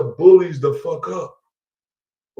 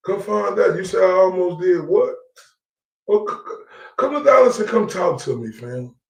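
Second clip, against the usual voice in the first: normal talking voice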